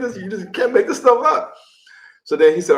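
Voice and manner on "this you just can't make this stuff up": amused disbelief. Retold or imitated. Laughing disbelief to brother.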